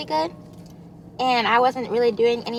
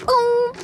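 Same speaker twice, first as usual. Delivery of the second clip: delighted ooh; 11 semitones higher, 6 dB louder than usual. Real, not acted. Reacting to cup of ice.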